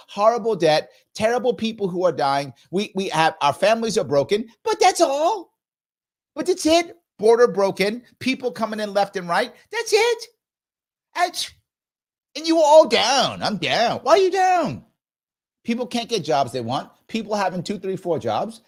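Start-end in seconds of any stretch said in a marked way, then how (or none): 4.6-14.8 sarcastic. Pretend. Sarcastically listing the country's problems.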